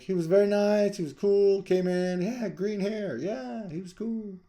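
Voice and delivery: very high mock New York voice, loud then easing off; high-pitched New York accent. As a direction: keep up a bad, squeaky New York impression. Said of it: "terrible New York high-pitched accent".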